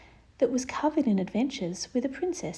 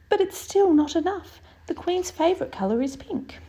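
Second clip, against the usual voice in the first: put-on worried old painter, pitch raised; higher fretful painter voice; 7 semitones higher than usual. Mister Painter to Annabel, high and fretful.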